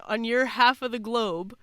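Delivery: ordinary delivery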